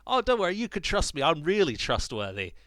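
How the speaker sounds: high, loud, overly sweet assurance; shifty creature voice. Retold or imitated. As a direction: sell it like a sneaky trickster. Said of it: "the creature says".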